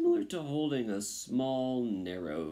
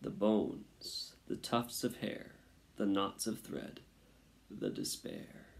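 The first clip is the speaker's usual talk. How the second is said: plain reading voice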